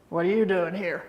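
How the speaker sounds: a hint of a gruff old boss, barked lightly. Gruff boss catching her working on a Sunday, lightly mimicked. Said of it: "In his gruff voice".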